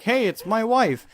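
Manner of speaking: acted-out movie husband. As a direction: pipe up as the husband. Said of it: "he's like".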